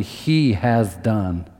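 He has done, slow with He stressed and falling; slow, weighty declaration; slow emphatic He has done. Shown as a demonstration. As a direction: drag out He has done, leaning on He. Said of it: "It's gotta be".

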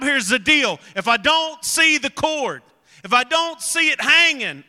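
high, loud, emphatic sermon delivery; impassioned preaching. Real, not acted. Preacher urging the congregation to find real hope, preaching at full force. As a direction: drive each phrase home to the congregation.

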